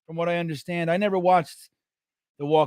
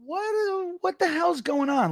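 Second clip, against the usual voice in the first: baffled; 10 semitones higher, 3 dB quieter than usual. Retold or imitated. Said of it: "some other people chime in and go".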